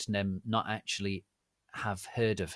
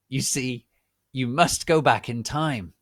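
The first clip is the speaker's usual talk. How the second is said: booming prophecy; 4 semitones higher, 9 dB louder than usual. Acted. Professor delivering a prophecy.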